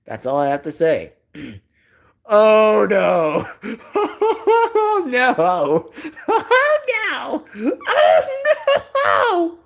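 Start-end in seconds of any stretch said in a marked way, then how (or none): 2.3-9.6 high squealing laughter breaking up the words; squealed cartoonish dismay; Tito. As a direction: wail through helpless laughter. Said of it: "I almost sound like Tito".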